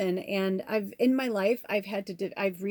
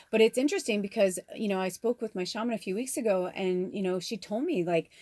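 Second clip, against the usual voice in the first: ordinary talk, nothing marked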